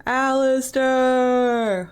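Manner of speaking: long drawn-out call. Real, not acted. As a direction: stretch the name out loud and slow. Calling out a name.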